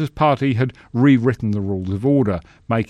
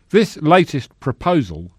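pompous senator oratory; 6 semitones higher, 5 dB louder than usual. Acted. Senator's speech.

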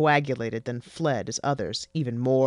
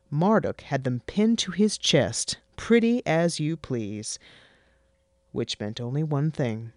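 ordinary delivery

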